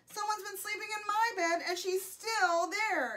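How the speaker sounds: high-pitched little-bear exclamation; exclaiming Baby Bear. A narrator's reading. Baby Bear discovers Goldilocks, exclaimed high. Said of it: "exclaimed Baby Bear".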